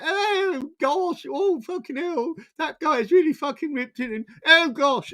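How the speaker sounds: mock a posh man swooning over a ripped guy; mocking posh gasp. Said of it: "he's probably gonna sit there".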